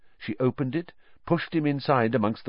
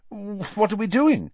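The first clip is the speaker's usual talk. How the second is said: lost; 11 semitones higher than usual. A narrator's reading. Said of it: "completely lost".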